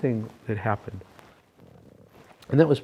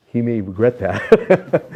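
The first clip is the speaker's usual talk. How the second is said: slow, louder words through real laughter; laughing; 2 semitones higher, 10 dB louder, much slower than usual. Real, not acted. Guest laughs while joking to the interviewer about Swami, laughing. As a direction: laugh out the words slowly at the joke.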